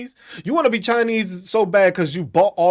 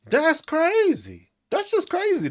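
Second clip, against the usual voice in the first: higher disbelief; 10 semitones higher, slower than usual. Acted. Repeats the phrase twice.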